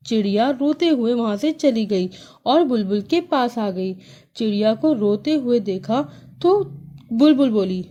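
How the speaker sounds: usual speaking voice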